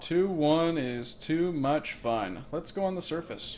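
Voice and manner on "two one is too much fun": drawn out, slow and playful; slow playful singsong. Pretend. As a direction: savour the rhyme slowly. Playful rhyme on a bearing.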